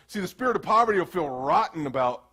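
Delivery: usual speaking voice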